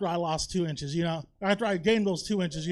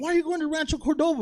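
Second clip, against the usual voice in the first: higher, quick, louder incredulous question; incredulous friend; 7 semitones higher, 5 dB louder, faster than usual. Retold or imitated. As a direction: fire it back at him in disbelief. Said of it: "She's like".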